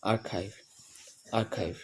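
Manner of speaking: quiet আর্কাইভ with aspirated kh; soft archive. Shown as a demonstration. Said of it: "সেখানে খ বলবো". (নরম স্বরে আর্কাইভ)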